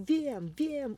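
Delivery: high, loud, delighted cry. A narrator's reading. The mouse overjoyed, with an idea to help the mower. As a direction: burst out with joy. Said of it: "uradowała się mała".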